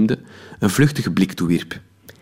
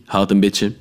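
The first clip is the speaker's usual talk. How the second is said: ordinary delivery, nothing marked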